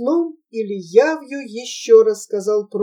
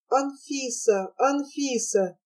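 faint distant calling; 7 dB quieter than usual. Acted. Calling through the blizzard.

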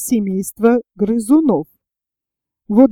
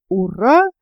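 usual speaking voice

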